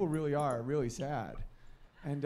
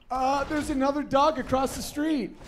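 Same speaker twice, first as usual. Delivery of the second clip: eager dog voice; 10 semitones higher, 7 dB louder than usual. Retold or imitated. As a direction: pull toward the other dog with your voice. Dogs pulling on leash.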